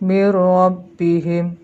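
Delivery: mir rabbihim slow and loud, n vanished into a held r; merged reading. Shown as a demonstration. Teacher shows how nun melts into ro, merged recitation. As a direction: lean on the doubled r in mir rabbihim. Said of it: "lebur ke dalam huruf berikutnya".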